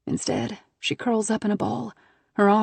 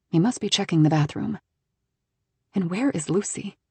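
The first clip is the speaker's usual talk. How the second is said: plain reading voice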